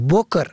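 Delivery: بکر said "boker"; ضمه on first letter. Shown as a demonstration. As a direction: say بکر with a short o after b. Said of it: "به ضم اول". (بکر با ضمهٔ ب: بُکر)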